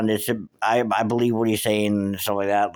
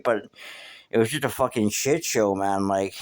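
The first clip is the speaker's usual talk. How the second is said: usual speaking voice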